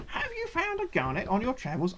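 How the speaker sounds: high gnome voice, mildly excited. A narrator's reading. Gnome asking after a garnet, excited high voice. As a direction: ask as an eager little gnome. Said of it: "the gnome seems excited and says".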